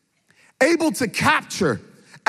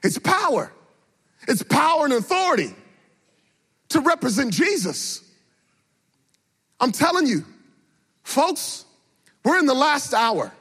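high, rising preacher's cry; a pastor rousing believers to compel others; full-force preaching; 5 semitones higher than usual. Acted. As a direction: preach it with fire, building.

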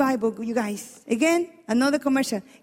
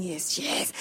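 Sarah gloating; 9 semitones lower, 9 dB quieter than usual. Retold or imitated. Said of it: "I can imagine Sarah going".

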